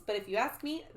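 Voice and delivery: usual speaking voice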